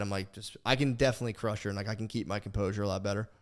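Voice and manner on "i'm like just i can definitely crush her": ordinary delivery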